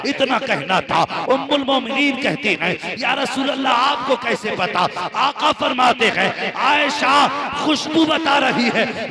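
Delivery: high, loud impassioned storytelling sermon; preaching at full force. Acted. Preacher telling the congregation Uvais Qarni's story, fervent preaching. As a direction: preach it to the crowd with rising fervour.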